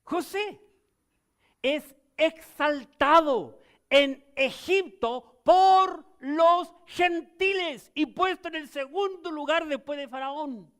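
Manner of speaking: loud, slow, exalted proclamation; full-force preaching. Acted. Pastor proclaims Joseph's exaltation to the church, loud and slow. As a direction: lift it up like a triumph.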